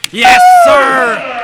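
a sudden, very high, loud yell of joy; excited shout. Real, not acted. Play-by-play announcer to radio listeners after a three, ecstatic yell. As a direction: whoop it up as the three drops.

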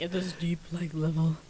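imitating a low deep voice; a hint of a deep man voice; mock deep voice. Shown as a demonstration. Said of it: "'Cause you talk".

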